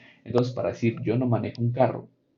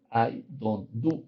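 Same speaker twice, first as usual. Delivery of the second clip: slow dictation; much slower than usual. Shown as a demonstration. Maestra lee la oración modelo.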